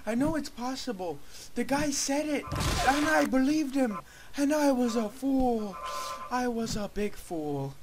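high, whiny self-pity; wailing lament. Acted. Gamer regretting believing the guy, high wailing lament. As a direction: whine it high, building then easing.

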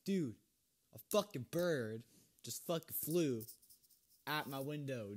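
loud, shocked swearing; startled shout. Real, not acted. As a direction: jump out of your skin and yell. Startled by bird at window.

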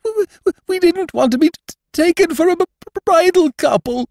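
put-on tearful young bride stuttering between sobs; sobbing. A narrator's reading. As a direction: choke out the words while crying. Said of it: "sobbed Missus Brede".

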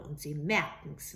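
usual speaking voice

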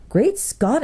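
high-pitched startled outburst; exclaiming. A narrator's reading. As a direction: exclaim it high, taken aback. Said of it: "exclaimed Eustace".

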